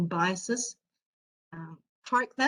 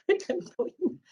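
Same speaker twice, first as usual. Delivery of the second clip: repeated with a laugh, high and slow; riendo; 10 semitones higher, slower than usual. Shown as a demonstration. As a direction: laugh it out, stretching the words. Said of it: "So I like to say".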